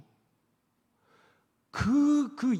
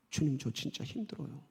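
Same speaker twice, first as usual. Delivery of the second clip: quiet, low plea to God; soft prayer; 4 semitones lower, 9 dB quieter than usual. Acted. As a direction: confess it softly. Praying quote to the Lord. (조용히 하나님께 아뢰듯)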